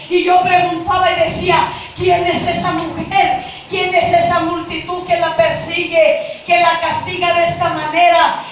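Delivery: loud, urgent retold questions; full-force preaching. Real, not acted. Preacher retelling how she asked who the woman is, forceful voice.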